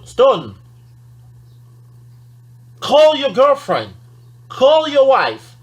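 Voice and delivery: loud, high, slow bossy order; blunt command. Shown as a demonstration. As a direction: bark it like a rude command. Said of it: "Ça sonne un tout petit peu ... impoli".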